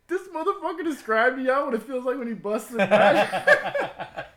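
high, loud, slow laughing disbelief; imitating Chucky's outburst. Friend reacting to sex ed story, high laughing disbelief. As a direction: squeal it out in amazed laughter.

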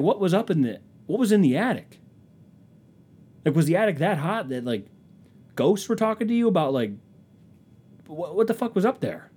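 high-pitched incredulous questioning; acted-out astonishment, pitch jumping up; stunned disbelief. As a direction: demand an explanation in bewilderment. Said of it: "I was stunned".

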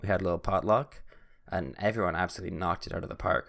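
ordinary delivery

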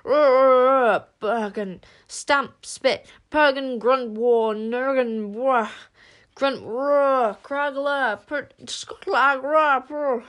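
high, loud frantic beast-speech. Acted. Werewolf desperately warning them off, snarling. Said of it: "got more urgent still".

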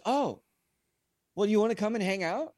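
high, bright, quick imitation of the rock star; casual invite. Frank voiced inviting him.

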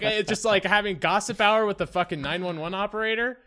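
ordinary talk, nothing marked

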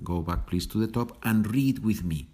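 usual speaking voice

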